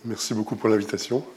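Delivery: usual speaking voice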